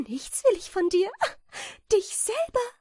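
very high, loud menacing character voice; predatory bat. A narrator's reading. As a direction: pounce on your prey in a high voice. Bat about to eat the moth. (bedrohliche Fledermaus, sehr hohe Stimme)

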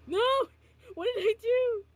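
high, loud startled yelp; alarmed cry. Real, not acted. Streamer fumbling while sharing the song, high alarmed cry. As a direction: blurt it in sudden panic.

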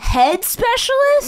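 very high, slow, loud echo; stunned disbelief, drawn out; incredulous. Real, not acted. Disbelief at the idea.

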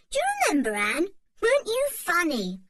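high, mocking little-brother voice; teasing boy. A narrator's reading. Dick teases Anne about her dolls, high teasing voice. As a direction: poke fun at your sister.

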